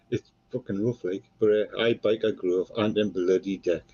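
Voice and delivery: normal talking voice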